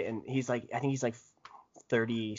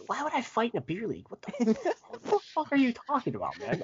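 mock incredulous; 11 semitones higher than usual. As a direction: pitch jumps high, breaking into a laugh. Retold denial of wanting to fight.

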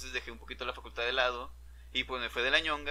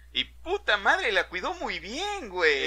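slow, higher and louder bitter sarcasm; sarcastic outburst; 6 semitones higher, 7 dB louder, slower than usual. Real, not acted. Telling how his best friend betrayed him, loud sarcasm. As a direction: drag it out with biting irony.

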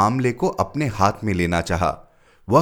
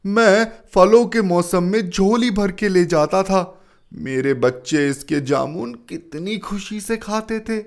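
choked up; 11 semitones higher, 4 dB louder than usual. A narrator's reading. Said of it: "गला भर आया".

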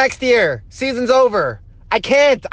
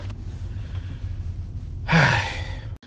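long, quiet, breathy sigh; 8 semitones lower, 10 dB quieter than usual. Real, not acted. Mets fan winding down after an injury rant, deflated sigh. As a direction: let out a heavy, deflated sigh.

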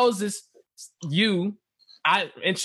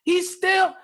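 exasperated; 10 semitones higher than usual. Real, not acted. Exasperated at Moses backing out.

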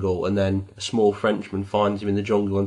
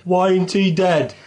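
high, loud mock shout; indignant shout at the radio; retold shout; 9 semitones higher, 4 dB louder than usual. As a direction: yell it in outrage at the wheel. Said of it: "slammed the brakes on and shouted".

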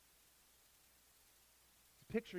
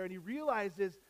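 ordinary delivery

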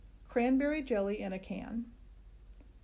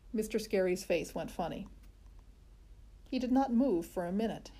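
usual speaking voice

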